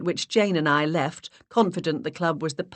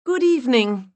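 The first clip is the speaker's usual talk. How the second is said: put-on higher and louder, stronger accent; high breathy put-on voice; 8 semitones higher, 8 dB louder than usual. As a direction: voice raised an octave, stronger English accent. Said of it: "I raised my voice an octave and increased the strength of my accent".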